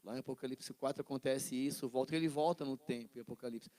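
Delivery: ordinary talk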